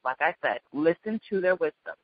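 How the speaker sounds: usual speaking voice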